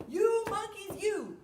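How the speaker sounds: high, loud, slow mock-furious shout; theatrical angry demand; mad peddler. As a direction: stamp and yell it at the monkeys. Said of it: "He was mad".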